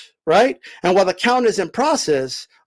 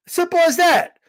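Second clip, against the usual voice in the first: ordinary delivery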